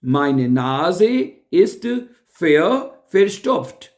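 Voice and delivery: pause, then verstopft alone; word split off. Shown as a demonstration. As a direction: hold Meine Nase ist, wait, then give verstopft on its own. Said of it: "stop नहीं कहते".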